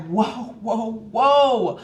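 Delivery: acted-out startled halt; alarmed interruption. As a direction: cut them off, building to the last word. Halting the hasty attendant, retold.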